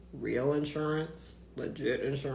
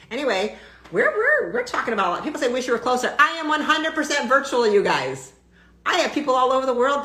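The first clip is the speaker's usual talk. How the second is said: fired-up rant, loud and higher; 9 semitones higher, 10 dB louder than usual. Real, not acted. To her viewers about seeing patients worldwide, a loud fired-up rant. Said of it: "so that was my rant".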